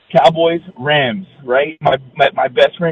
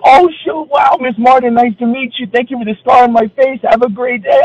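surprised fan, high; 7 semitones higher, 3 dB louder than usual. Retold or imitated. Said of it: "And I'm like".

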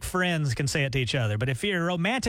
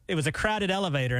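ordinary talk

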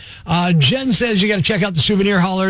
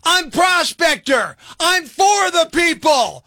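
high, slow, drawn-out crowd-rouser; rallying cry; 6 semitones higher, slower than usual. Acted. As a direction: proclaim it like rabble-rousing. Rallying listeners to end rant.

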